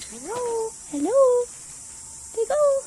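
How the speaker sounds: very high pet-talk voice. Real, not acted. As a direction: talk sweetly to a bird. Talking to the parrot.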